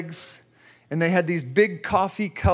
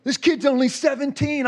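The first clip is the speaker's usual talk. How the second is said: tearful; 7 semitones higher than usual. Retold or imitated. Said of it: "like, crying".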